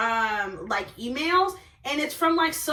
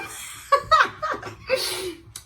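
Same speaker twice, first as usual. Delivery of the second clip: high, drawn-out cackle; laugh; 12 semitones higher than usual. Real, not acted. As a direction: crack up at your own joke. Laughs at own retort.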